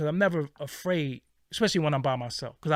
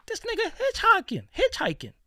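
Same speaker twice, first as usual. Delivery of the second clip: much higher, squealing amazement; disbelief. Real, not acted. Seeing the same man outside hitchhiking, high squealing disbelief. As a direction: gasp it out in disbelief.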